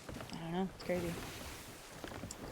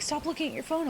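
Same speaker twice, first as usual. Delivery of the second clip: mock nagging; 9 semitones higher than usual. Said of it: "People are like".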